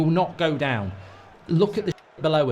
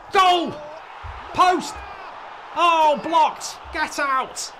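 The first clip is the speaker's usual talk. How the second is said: excited shouts; 9 semitones higher, much slower than usual. Real, not acted. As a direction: yell along as the ball hits the post. Near goal, shouting chances live.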